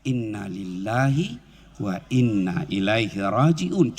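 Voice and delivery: slow, low, drawn-out istirja; unhurried, heartfelt prayer words; slow recitation. Shown as a demonstration. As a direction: recite it slowly with a hand on the chest. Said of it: "jangan cepat ketika ngucapnya".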